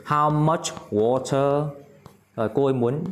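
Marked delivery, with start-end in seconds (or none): 0.1-1.7 slow, careful teacher model; slow model phrase. Shown as a demonstration. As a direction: spell it out slowly for learners. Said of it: "how much, nước danh từ không được". (đọc mẫu chậm rãi)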